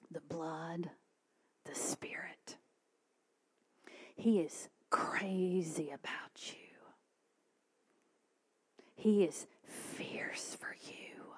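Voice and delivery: slow, whispered assurance; very soft, reverent preaching; hushed reverence. Real, not acted. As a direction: whisper each phrase with weight. Solemn assurance to congregation.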